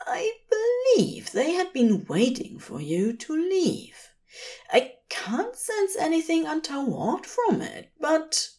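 a nervous, prim angel half-whispering his suspicion; fussy angel voice. Acted.

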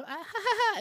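very high hammed-up "ha ha ha"; imitated loud laugh. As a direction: show off that big silly laugh. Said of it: "my laugh is go".

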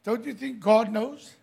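ordinary talk

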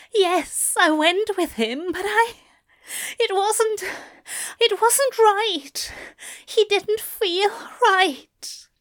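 high, halting confession; tearful, trembling. A narrator's reading. Aziraphale confessing to lover, close to tears. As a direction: confess high and upset, breaking off. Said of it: "Aziraphale is trembling, his eyes wet".

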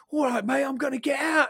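loud, high Cockney shout; mock Cockney. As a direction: holler like a Cockney henchman in an action film. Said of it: "Why are you Cockney?".

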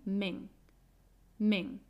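"men" set apart as a stressed beat; strong syllable alone. Shown as a demonstration. Teacher pointing out second strong syllable, "men" stressed alone. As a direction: isolate "men", then repeat it after a pause. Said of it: "la segunda sílaba fuerte está en".

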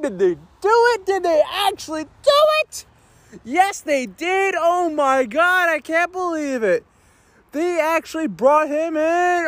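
high, slow, squealing oh my God; can't believe what you're seeing, voice soaring high; excited disbelief. Real, not acted. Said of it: "I am sorry for screaming".

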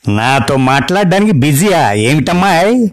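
put-on an old granny demanding attention; scolding grandma. Grandma on the phone orders the wife, scolding old-woman voice. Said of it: "బామ్మగారి గొంతు".

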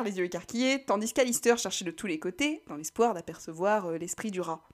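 plain reading voice

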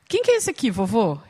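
imitating a little girl's question; curious daughter. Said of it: "minha filha mais velha tava perto e falou assim".

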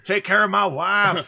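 loud, high, slow dramatic soldier plea; hammed-up war movie last words; dying soldier. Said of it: "break out the arm voice".

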